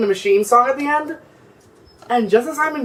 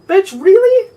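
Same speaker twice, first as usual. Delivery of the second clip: incredulous; 11 semitones higher, 4 dB louder than usual. Real, not acted. Outraged at adult attacking kids.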